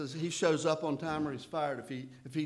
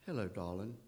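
put-on sweet old southern lady; 5 semitones lower, 5 dB quieter than usual. [name] greets him sweetly.

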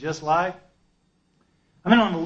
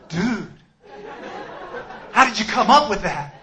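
laughing praise; 7 semitones higher than usual. Retold or imitated. Fan gushes to the author, laughing and higher. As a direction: gush at him through laughter.

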